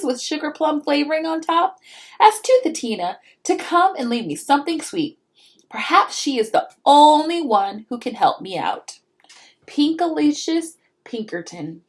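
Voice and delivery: put-on a little girl politely asking; child's letter. Pinkalicious writes to the elf, pleading child voice. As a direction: read the letter out, sweetly pleading.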